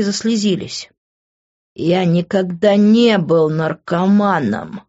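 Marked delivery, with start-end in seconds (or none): none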